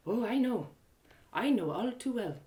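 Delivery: soft, guarded woman's reply; hushed, measured admission; knowing understatement. Acted. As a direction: let it out quietly, with history behind it. Guarded woman speaks of the couple.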